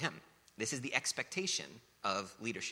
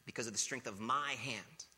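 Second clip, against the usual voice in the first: mock boast; 2 semitones higher than usual. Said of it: "You say".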